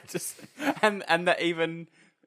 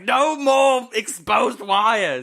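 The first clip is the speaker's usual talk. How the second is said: slow, louder, higher tiger-mum scold; mock tiger mum telling off her child; 7 semitones higher, 7 dB louder, much slower than usual. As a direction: drag it out like a strict mother. Tiger mum voiced scolding robot.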